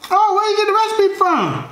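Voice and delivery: high falsetto mock curiosity; exaggerated squeaky questioning; impressed caller. Acted caller reacting to cooking.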